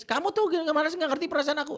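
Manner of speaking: high, loud whiny complaint of a hurt wife; mock crying wife. Wife crying at her husband, a high mock wail. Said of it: "Akhirnya dia nangis".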